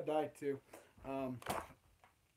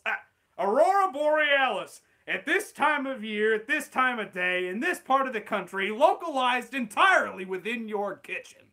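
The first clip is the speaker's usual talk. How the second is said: louder, higher sarcastic disbelief; incredulous Chalmers; 5 semitones higher, 9 dB louder than usual. Acted. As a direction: pile up the absurd details loudly. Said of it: "Steamed Ham script".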